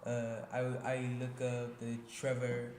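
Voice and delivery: careful, deliberate Nigerian accent demo; Nigerian-accented explanation. Shown as a demonstration. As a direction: put on a Nigerian accent, feeling out the words. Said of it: "Can you do the little Nigerian accent".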